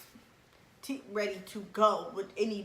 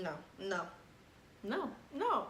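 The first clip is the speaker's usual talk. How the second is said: normal talking voice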